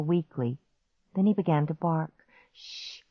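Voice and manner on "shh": hushed, voiceless shush; whisper. A narrator's reading. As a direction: shush the dog under your breath. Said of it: "I whispered".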